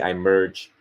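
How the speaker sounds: ordinary delivery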